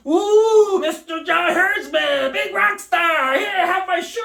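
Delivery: high, loud gushing fan squeal; swooning fan. Retold or imitated. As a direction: squeal like a starstruck fan begging for the shirt. Fan swooning at rock-star coach.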